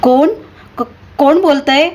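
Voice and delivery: a shaky high stammer of fear. Acted. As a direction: stammer it out, terrified. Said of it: "थरथरत्या हाताने उचलून".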